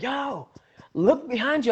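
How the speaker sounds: high-pitched urgent warning; imitated bystanders. Bystanders warning an oblivious fan, imitated urgency. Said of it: "But everybody's like".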